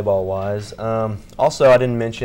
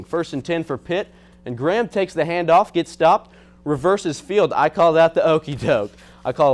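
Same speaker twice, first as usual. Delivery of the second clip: sports play-by-play; 5 semitones higher than usual. Acted. Sports highlight narration.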